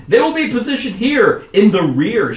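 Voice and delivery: loud, high, projected orders; commanding leader. Acted. Commander pointing out positions on the map, loud high orders. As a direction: call out positions emphatically.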